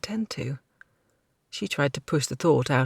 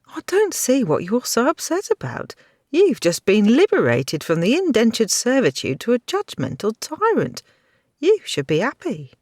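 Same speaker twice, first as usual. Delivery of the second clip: higher, mocking grudging apology; a lightly voiced cheeky girl sneering through it; sarcastic young woman; 7 semitones higher, 5 dB louder than usual. Kirsten voice.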